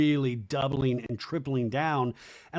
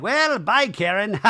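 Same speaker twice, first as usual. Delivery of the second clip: high, loud sassy goodbye; mock sassy brush-off; 6 semitones higher, 6 dB louder, slower than usual. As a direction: imitate Brock dismissing her with a flourish. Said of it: "Brock's like".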